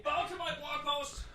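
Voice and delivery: distant high yell offstage; from another room. Real, not acted. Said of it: "[name]'s still yelling from the other room".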